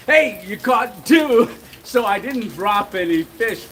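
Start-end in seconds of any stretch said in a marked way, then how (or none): 0.1-1.5 slow, loud, higher hey you caught two; teasing shout. Retold or imitated. Father-in-law teasing after tossing a second fish, slow playful shout. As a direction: call it out slowly like a joke.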